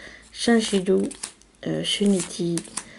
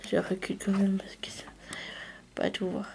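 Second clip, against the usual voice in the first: hushed aside; 10 dB quieter, faster than usual. Real, not acted. Whispering aside while filming.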